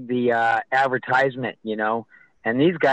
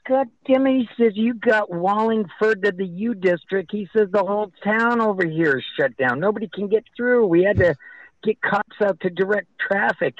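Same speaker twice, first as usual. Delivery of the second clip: high-pitched, worked-up complaint; exasperated official; 7 semitones higher than usual. Retold or imitated. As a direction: imitate an official's exasperated rant. Retold official complains about traffic.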